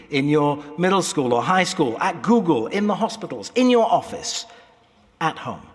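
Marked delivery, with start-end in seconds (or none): none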